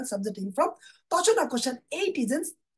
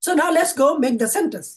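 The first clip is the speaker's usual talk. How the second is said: rallying call; 4 semitones higher, 6 dB louder, much slower than usual. Real, not acted. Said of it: "teacher rallies the class".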